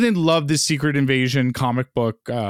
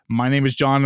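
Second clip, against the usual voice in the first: ordinary delivery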